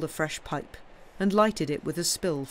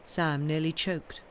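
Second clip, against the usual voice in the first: ordinary delivery, nothing marked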